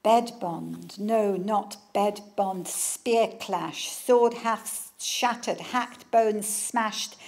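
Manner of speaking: slow, hammered battle verse; dramatic poetry reading. Acted. Author reading Tony Harrison's war verse, slow and forceful. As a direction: strike each word like a blow. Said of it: "But now listen to this".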